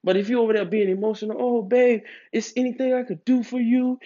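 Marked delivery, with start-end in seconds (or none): 1.4-4.0 mock needy boyfriend. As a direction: ham up the overeager partner. Mocking the overeager emotional man.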